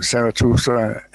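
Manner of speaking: ordinary delivery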